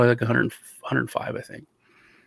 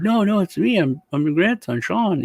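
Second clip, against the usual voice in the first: high, insistent pleading; mock pleading grandson; 6 semitones higher than usual. Grandson insists to grandpa it is him, high voice. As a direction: beg him to believe you. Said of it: "that's when I said".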